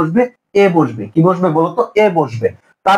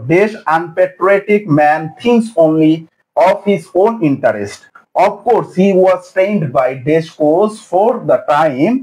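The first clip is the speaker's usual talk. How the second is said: each word read out slowly; slow reading aloud; much slower than usual. Shown as a demonstration. Teacher reading exam sentences slowly to the class.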